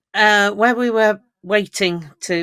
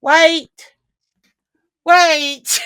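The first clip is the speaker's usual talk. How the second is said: higher, louder mock call of wait; a hammed-up shout of wait; imitating a player; 10 semitones higher, 7 dB louder than usual. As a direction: play the bored player calling out. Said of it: "standing there going".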